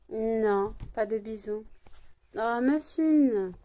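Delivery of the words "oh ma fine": higher, louder, slow coo; drawn-out tender call to the pet; fond coo to the dog on her lap. Real, not acted. Cooing to the dog.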